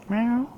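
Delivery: high-pitched meows to lure a cat; imitated cat meow. Real, not acted. Luring the cat.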